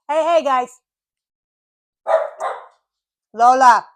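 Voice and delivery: loud, sharp real call; calling to dogs. Real, not acted. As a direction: break off and get the dogs' attention.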